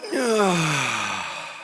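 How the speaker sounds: long, falling sigh of relief; deep exhaling sigh. Shown as a demonstration. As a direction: breathe out slowly as if exhaling cigarette smoke. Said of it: "everybody do this with me".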